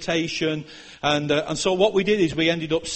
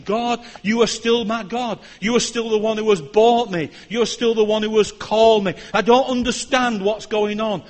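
firm, louder declaration of faith; affirming prayer; 4 semitones higher, 5 dB louder than usual. Retold or imitated. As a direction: affirm each line to God. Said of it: "I had to pray affirmation".

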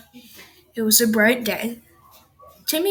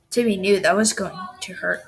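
ordinary delivery

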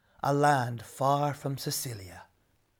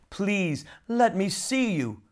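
pleading king, pitched higher; imploring; 4 semitones higher than usual. A narrator's reading. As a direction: beg her desperately. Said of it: "the king implored".

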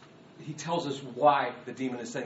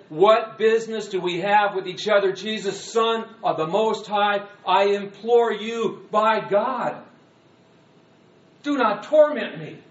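high, drawn-out shouted plea; loud shout; 4 semitones higher, 8 dB louder than usual. Acted. Tormented man imploring Jesus, shouting loudly. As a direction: cry out and implore at full voice. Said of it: "And he shouted in a loud voice".

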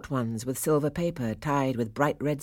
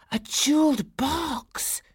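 high, drawn-out squeal of delight; excited cry; 12 semitones higher, slower than usual. A narrator's reading. Another girl opening her present, excited cry. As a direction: cry out high and slow, savouring it. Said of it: "cried another".

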